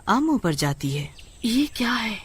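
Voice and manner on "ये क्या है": shocked whisper. Acted. Finds mangoes missing.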